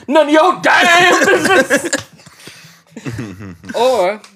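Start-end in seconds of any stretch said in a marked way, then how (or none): 0.1-1.9 slow, loud declaration; shouting. Acted. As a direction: belt it out slowly. Card line shouted.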